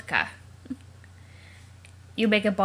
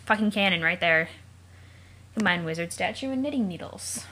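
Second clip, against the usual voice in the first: usual speaking voice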